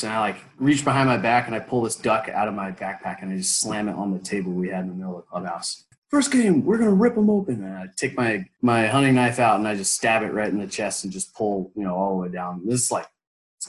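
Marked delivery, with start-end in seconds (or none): 6.1-7.6 roaring war cry, pitched way up; battle cry. Retold or imitated. To teammates in the clubhouse, a loud high war cry. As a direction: bellow it like a warlord rallying troops.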